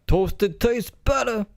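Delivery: high, slow put-on pitchman voice; Quiznos ad guy. Bit recalling a Quiznos ad, done as a high, slow mock pitchman. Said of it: "He was like".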